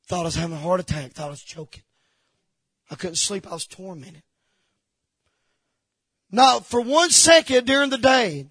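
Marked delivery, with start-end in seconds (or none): 0.1-4.2 low voice, sinking very soft; subdued, low testimony of suffering; hushed confession. Real, not acted. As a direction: let it drop away as you admit it. Recounting his panic attacks.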